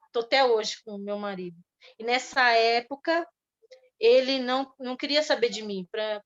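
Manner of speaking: normal talking voice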